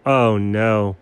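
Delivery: normal talking voice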